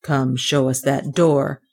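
low, slow order; gruff policeman. A narrator's reading. Suspicious policeman orders the children along, gruff. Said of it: "he said gruffly".

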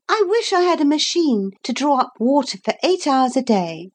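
put-on high and quick little girl. Shown as a demonstration. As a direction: rattle it off quickly. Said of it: "Say it all again and slower".

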